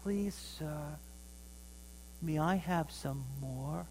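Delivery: small, timid plea, soft and slow; a shy orphan boy asking softly; meek whisper-soft request. Retold or imitated. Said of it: "in a real soft voice".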